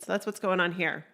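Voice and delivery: ordinary talk, nothing marked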